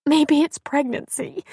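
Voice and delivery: slow, high tearful wail; weeping. A narrator's reading. Pregnant woman excuses herself to friend, weeping. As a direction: wail it feebly through tears. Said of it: "Abby wept".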